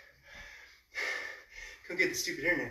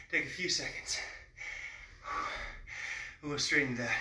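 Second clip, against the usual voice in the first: winded between exercises; panting between words; out of breath; 2 semitones lower, 5 dB quieter than usual. Real, not acted. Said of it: "Trying to catch my breath".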